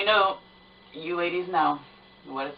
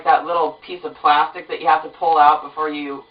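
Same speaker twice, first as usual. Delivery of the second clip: ordinary delivery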